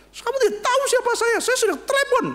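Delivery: high, shrill yell of an entitled passenger; angry shout. Acted. Mother on plane scolding flight attendant, shrill shout. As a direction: blast it at the stewardess, outraged.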